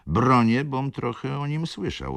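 ordinary delivery, nothing marked

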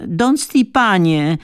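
high, loud, drawn-out shout of the name; slow, high and loud calling shout. A narrator's reading. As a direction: yell the name from right behind the door. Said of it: "krzyknęła kobieta już zupełnie blisko".

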